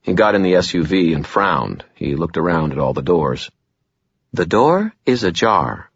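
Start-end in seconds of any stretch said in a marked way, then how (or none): 4.3-5.9 slow machine announcement voice; car dashboard voice. A narrator's reading. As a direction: speak like a talking car, slow. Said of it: "the dashboard said".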